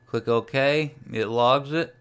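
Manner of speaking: ordinary delivery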